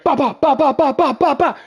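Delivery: high, loud rapid-fire "ba" bursts; imitated blasts. Game master imitates rapid attack noises.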